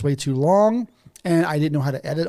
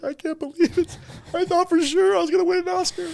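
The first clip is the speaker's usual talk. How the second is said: high, whiny mock lament; a melodramatic whine; mock despair; 14 semitones higher than usual. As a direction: pretend to be heartbroken, hammed up. Mock despair over the Oscar.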